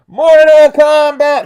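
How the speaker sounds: very high, loud, stretched movie-trailer yell; Mortal Kombat shout. Pretend. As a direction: belt it like the theme song. Shouting the movie theme call.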